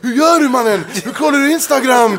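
high, loud, laughing imitation of shocked friends; laughing mock outrage. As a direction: play the baffled friend, laughing. Retelling people's shocked reactions.

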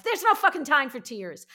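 retold yell. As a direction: shout it like there is no time to lose. Retelling own blunt reaction.

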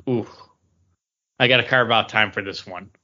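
ordinary delivery

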